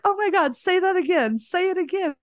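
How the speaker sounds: high-pitched imitation of excited fans; delighted amazement. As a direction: mimic someone begging to hear it again. Locals delighted by her accent, retold.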